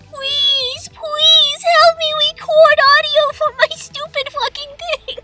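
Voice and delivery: annoying pleading. Retold or imitated. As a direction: beg like a bratty kid pestering friends. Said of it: "amping up my annoyingness to one hundred while I pleaded".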